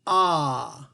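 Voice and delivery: ah voiced firmly so the throat buzzes; vowel demo. Shown as a demonstration. Teacher to children, testing ah for vibration. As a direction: feel the ah vibrate. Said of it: "What about ah?".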